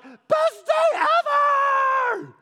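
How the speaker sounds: high, loud squeal of joy; excited kid. Retold or imitated. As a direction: shriek like a thrilled little kid. Kid excited over ice cream.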